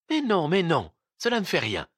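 high-pitched, mild protest. A narrator's reading. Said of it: "protesta Ninotchka".

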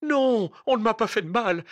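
high, loud, breathless denial; out of breath from running in terror; panting. A narrator's reading. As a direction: gasp it out in fear. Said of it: "haleta l'autre".

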